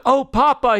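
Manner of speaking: put-on high, plaintive little-child voice; small, pleading storybook whimper. A narrator's reading. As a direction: whimper for papa, pitched high. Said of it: "he whimpers".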